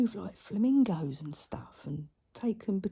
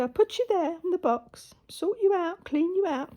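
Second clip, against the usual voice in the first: put-on high, sing-song baby talk; settling the traumatised pigeon in its box, high baby talk; pet talk; 10 semitones higher than usual. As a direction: soothe a frightened little bird. Talking sweetly to the pigeon.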